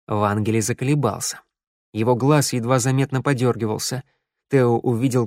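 usual speaking voice

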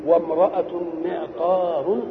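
وامرأة معطار stretched very slowly, rising; slow teaching example. Shown as a demonstration. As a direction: draw out وامرأة معطار for the students. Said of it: "تقول إيه؟". (نطق بطيء للمثال)

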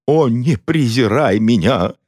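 slow, high, theatrical wail; mock-tragic tearful plea; sobbing plea. A narrator's reading. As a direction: wail it like a melodrama heroine. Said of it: "зарыдала графиня".

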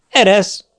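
high, loud let-go cry; shouted command. A narrator's reading. Poor man shouts at the devil holding him, loud and high. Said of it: "kiáltotta a szegény ember".

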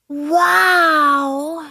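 high, long-drawn wow; Olaf. A narrator's reading. Snowman amazed at the ice ramp, high drawn-out awe. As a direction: marvel in a stretched-out high voice. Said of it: "staunte Olaf".

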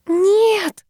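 long, high-pitched wailed moan. A narrator's reading. Owner watching cat destroy her toy, moaning. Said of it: "простонала я".

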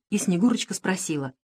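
ordinary delivery

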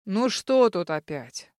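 annoyed demon man peeking in; displeased man. A narrator's reading. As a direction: grumble like an annoyed dad. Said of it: "прозвучал недовольный мужской голос".

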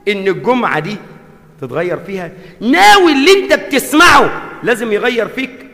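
impassioned sermon, rising and falling; fiery Friday preacher; preaching. Acted. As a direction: preach it to the congregation. Friday sermon at full force.

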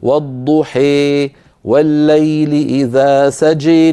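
slow Quranic recitation, سجى with tilted alif; recited with imala. Shown as a demonstration. Teacher recites to students, slow tajweed chant with imala. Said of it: "عندما نقرأ لحمزة والكسائي نقول".

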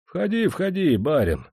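fawning, sing-song welcome to the master; flattering invitation. A narrator's reading. Cook flatters his master at the door, fawning. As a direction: coax the master inside, ingratiating. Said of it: "льстиво позвал".

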